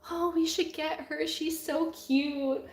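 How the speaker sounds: gushing husband. Retold or imitated. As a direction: coo it like the husband falling for her. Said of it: "my husband was like".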